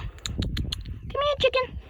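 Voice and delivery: high, breathy coaxing call; calling chickens. Real, not acted. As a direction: coax the hen closer.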